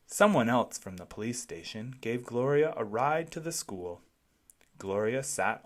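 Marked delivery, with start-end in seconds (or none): none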